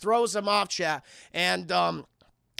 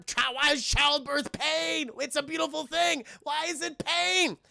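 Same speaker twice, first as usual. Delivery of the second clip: high, slow, strained wail; pained gremlin voice; 6 semitones higher, slower than usual. Retold or imitated. Streamer as Stripe birthing gremlins, hammed-up pained creature voice. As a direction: groan through mock labor pains.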